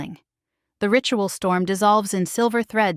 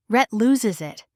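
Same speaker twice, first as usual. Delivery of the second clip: ordinary delivery, nothing marked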